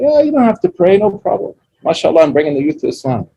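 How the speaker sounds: high, breezy mock of a trendy imam; a hammed-up careless preacher voice; mock cool preacher. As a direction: brush it off like a too-easy teacher. Mocking a too-cool preacher.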